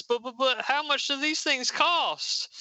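high, sputtering objection; acted worry, tripping over the first word; flustered stammer. Violet fretting to her teammate about money, high flustered stammer.

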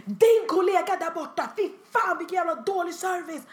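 mock outraged yelling; yelling customer. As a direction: imitate a customer's shrieking rant. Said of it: "hon bara står och skriker".

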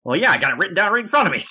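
high, fast, eager comeback; mock-eager rush of words, pitched high; excited retold boast. Acting out Paul E's excited boast.

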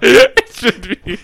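slow, laughing repeat of the friend's words; laughing echo. Real, not acted. Echoing a friend's silly claim, slow through laughter. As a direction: let the laugh drag the words out.

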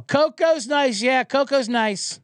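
high drawn-out mock owner; mock dog owner. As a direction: repeat it like a clueless owner. Mocking the owner on the trail.

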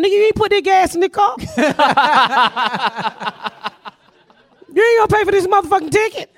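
high, heated rant, speeding up; laughing mock tirade. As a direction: scold the nosy neighbor, laughing through it. Ranting at imagined neighbor.

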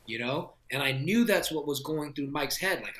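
ordinary delivery

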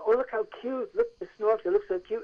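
high-pitched mock gushing; imitated chorus of cooing; cooing admirers. Everybody shown the Snorks picture, cooing.

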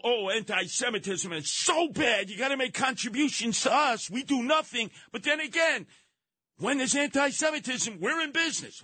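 high, shouted mock plea; sarcastic high mock pitch of a donation appeal; mocking fundraiser voice. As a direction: ham up a greedy fundraiser begging for money.

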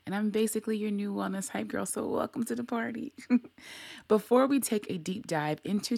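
put-on bright, fast, high hype-girl welcome; upbeat podcast host welcome; hype podcast intro. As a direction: welcome them to the party, all energy.

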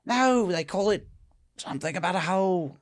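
put-on upper-class English; fancy English. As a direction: search for the name in posh English. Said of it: "Is this fancy English".

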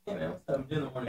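very soft, off to the side; hushed phone aside. Real, not acted. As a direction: answer the phone under your breath. Takes a phone call aside.

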